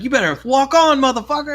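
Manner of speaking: high-pitched mock threat; imitated quarrel. Two men going at it again, imitated angry threat. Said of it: "they start going at it again".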